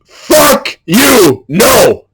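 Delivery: shouted, raw refusal; defiant shout. Shown as a demonstration. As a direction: hurl it at the world.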